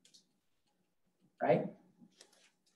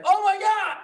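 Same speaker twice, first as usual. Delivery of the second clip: high, loud wail of overwhelm; mock panic; 5 dB louder than usual. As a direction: cry out as if life is shattering, hammed up. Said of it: "their life shatters".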